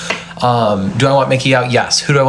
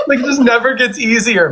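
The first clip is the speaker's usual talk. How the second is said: high, wobbly mock-crying voice; hammed-up sad sigh of fake grief; mock tearful; 10 semitones higher than usual. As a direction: fake being choked up over an eviction. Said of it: "I saw everybody else crying and I was like".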